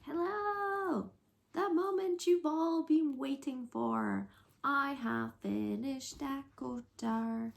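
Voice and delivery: high bright showy greeting; grand entrance announcement. Real, not acted. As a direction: announce it like a big reveal. Returning with finished book.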